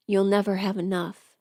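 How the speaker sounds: normal talking voice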